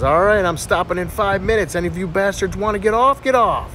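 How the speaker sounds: train conductor. Acted. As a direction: call out stops like a bossy conductor. Boy playing gruff train conductor.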